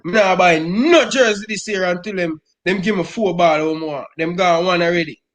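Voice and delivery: normal talking voice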